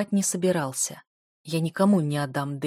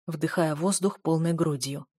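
ordinary delivery

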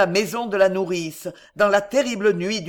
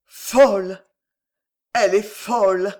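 loud cry of despair; despairing clamour; 5 dB louder than usual. A narrator's reading. Maréchal hearing Jeanne's news, loud despairing clamour. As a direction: cry out as if cursing fate. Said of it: "Une clameur de désespoir, une imprécation terrible".

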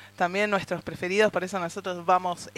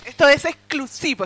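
louder, higher promo-style hype on exclusivo; a bit louder and higher, announcing an exclusive; hyped announcement; 6 semitones higher, 7 dB louder than usual. Acted. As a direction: sell it like a big reveal. Hyping exclusive material.

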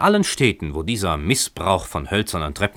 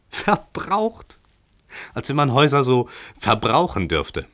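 "Verbraucht?" much higher, rising in disbelief; incredulous echo; 6 semitones higher than usual. A narrator's reading. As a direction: toss "Verbraucht?" back high and astonished.

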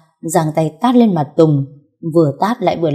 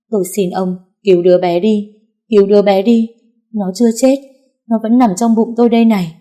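begging, rising higher and louder; desperate pleading; 3 semitones higher, 4 dB louder than usual. A narrator's reading. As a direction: plead on your knees for the baby. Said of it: "quỳ hai chân xuống ... nói". (van xin tuyệt vọng)

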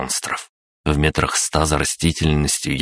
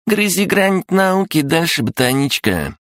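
издевательски; 14 semitones higher than usual. Acted. Парень продолжает дразнить девушку.

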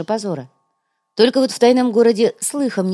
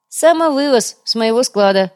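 louder, higher, gleeful announcement; joyful; 5 semitones higher, 6 dB louder than usual. A narrator's reading. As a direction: announce it happily, voice lifted and loud. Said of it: "радостно поведал Урбек".

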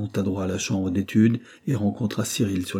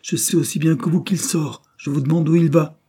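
raised, exasperated insistence; louder and higher, pushing for a straight answer; angry demand; 7 semitones higher, 5 dB louder than usual. A narrator's reading. Governess stamping her foot at Cyril, angrily insistent.

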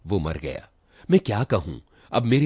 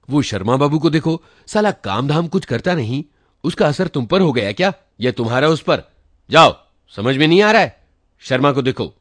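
loud, high furious telling-off; angry scolding; 7 semitones higher, 8 dB louder than usual. A narrator's reading. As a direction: berate him without a pause. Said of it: "वही डांट वाली आवाज थी".

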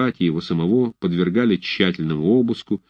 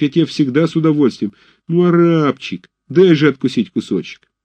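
pleading whine; 6 semitones higher, 4 dB louder than usual. A narrator's reading. Fedchenko pleads and swears.

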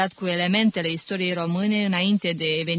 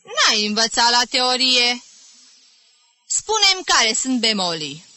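raised, mildly mocking teacher; lightly voiced stern music teacher; 5 semitones higher, 5 dB louder than usual. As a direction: quiz the pupil with sly menace. Teacher gloating over pupil. (profesor sever, ușor batjocoritor)

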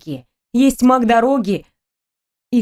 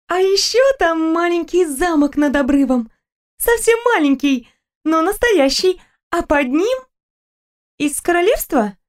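put-on higher, lively young woman; Stella's voice; 6 semitones higher than usual. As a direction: chatter happily about a trip. Stella's voice continues.

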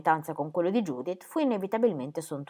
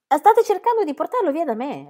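loud, higher indignant retort; 10 semitones higher, 8 dB louder than usual. A narrator's reading. The duchess indignantly rebuking the bishop. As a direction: accuse them sharply. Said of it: "lo apostrofò la duchessa".

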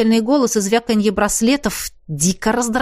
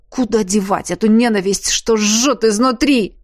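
ordinary delivery

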